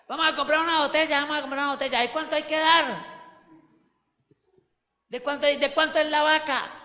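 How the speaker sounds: high, hurried pooling-money chatter; acted drinking buddy. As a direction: play a pal rallying friends for a bottle. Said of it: "escenas como la siguiente".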